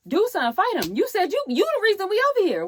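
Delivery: high, heated goading; retold urging. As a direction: mimic egging someone on, pitched high. Voices exasperated urging to fight.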